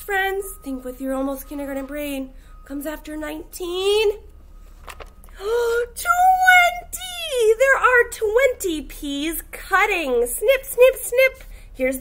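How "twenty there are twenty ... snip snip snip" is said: high gasp and a bright, high "Twenty"; excited reveal. Acted. Teacher revealing twenty to the class, excited. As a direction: make it a big moment.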